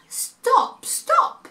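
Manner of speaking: very high stop stop; high squeaky stop; high boy's call. A narrator's reading. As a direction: call out as the boy. Boy voiced in story.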